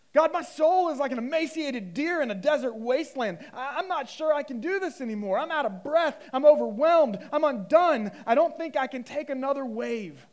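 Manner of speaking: high, loud, pleading cry; overwhelmed acted prayer. Preacher voicing an exhausted soul's prayer to God, overwhelmed. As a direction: cry out as if at the limit.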